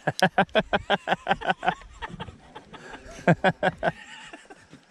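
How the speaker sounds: long, loud cackling laugh; uproarious laughter. Real, not acted. Mates after the talking-dog punchline, loud laughter. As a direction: crack up in rapid bursts.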